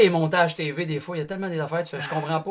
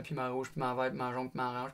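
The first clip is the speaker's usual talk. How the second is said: ordinary talk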